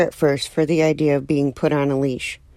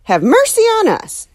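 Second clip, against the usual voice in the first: high, loud shocked cry; startled exclamation; 12 semitones higher, 3 dB louder than usual. Acted. Neighbor shocked at a penguin, exclaiming high. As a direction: gasp it out in alarm. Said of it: "she exclaimed".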